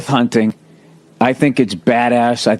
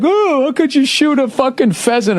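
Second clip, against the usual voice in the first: high-pitched hand-wringing protest; mock outrage; 12 semitones higher than usual. Hypocritical chicken eater scolding a hunter, mock outrage. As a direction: parody a squeamish objector, pitch way up. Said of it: "I'm gonna be like".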